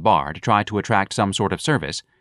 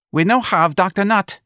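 high, louder barmaid voice; pushy barmaid; 11 semitones higher, 4 dB louder than usual. Acted. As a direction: announce it high and brash. Barmaid returns with champagne.

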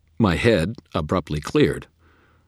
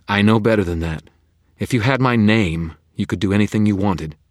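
put-on louder, higher firm young woman; defiant Susan; 6 semitones higher, 8 dB louder than usual. A narrator's reading. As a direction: stand your ground. Susan voiced by narrator.

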